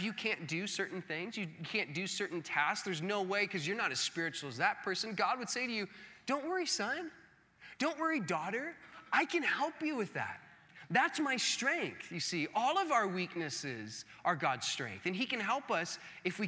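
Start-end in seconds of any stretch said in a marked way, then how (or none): 6.3-10.4 higher, gentle fatherly reassurance; God reassuring. Retold or imitated. God tells a weak believer not to worry, gently. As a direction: soothe a weak child kindly.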